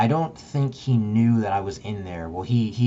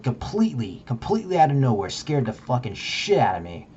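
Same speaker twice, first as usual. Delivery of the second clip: usual speaking voice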